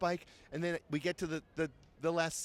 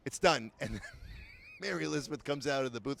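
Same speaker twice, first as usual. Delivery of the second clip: ordinary talk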